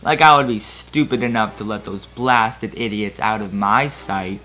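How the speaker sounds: plain reading voice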